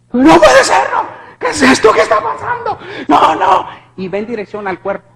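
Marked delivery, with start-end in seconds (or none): 0.1-3.7 high, alarmed shouting; acted panic, pitched way up; panicked disbelief. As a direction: cry out in horrified disbelief. Reliving horror as the man passes through.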